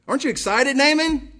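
high, eager teasing question; mock excitement. Preacher teasing Naaman excitedly.

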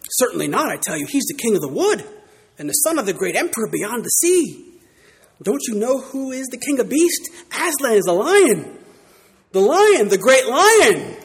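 put-on Mister Beaver telling the children about Aslan, sternly. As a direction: declare the king of beasts with awe. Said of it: "said Mister Beaver sternly".

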